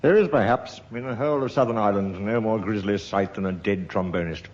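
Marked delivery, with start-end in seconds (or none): none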